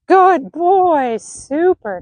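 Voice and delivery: high, loud, drawn-out praise; real, enthusiastic well-done; delighted praise. Real, not acted. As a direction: reward the horse with a big happy voice. Praising horse for coming in.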